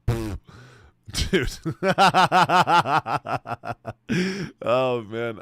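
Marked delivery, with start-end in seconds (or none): 1.6-4.6 high, loud burst of real laughter. Real, not acted. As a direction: crack up out loud.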